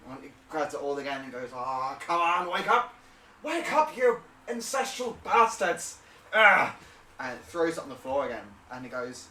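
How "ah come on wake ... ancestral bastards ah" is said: building to a high, loud yell; exasperated shouting. Acted. Man yelling at his ancestral runes, exasperated shouting. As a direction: shout angrily to rouse them.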